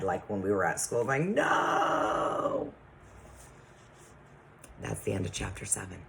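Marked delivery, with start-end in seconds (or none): 1.3-2.7 long, high, drawn-out mock wail; imitated pupils' protest. Teacher imitating the class when the chapter ends, mock protest. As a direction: mimic disappointed schoolchildren, stretching the word out high. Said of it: "I can hear you in my head".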